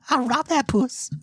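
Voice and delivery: silly high voice. Retold or imitated. Voicing raccoon.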